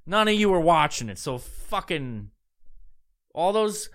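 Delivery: ordinary talk, nothing marked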